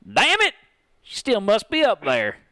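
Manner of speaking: high, loud frustrated curse; cursing. Acted. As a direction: swear out of frustration. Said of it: "Patrick cursed".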